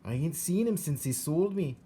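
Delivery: put-on high, rough country girl voice; matter-of-fact young servant girl; Mig's voice. A narrator's reading. Mig voiced in story.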